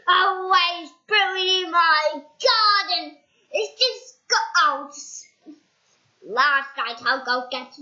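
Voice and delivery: loud, slow grumbling rant; grumpy rant. Acted. Mister Appleseed ranting about goblins, loud and slow.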